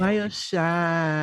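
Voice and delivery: drawn-out mock party girl; slow girly plea. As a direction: stretch it like a needy party girl. Mocking girls asking for drinks.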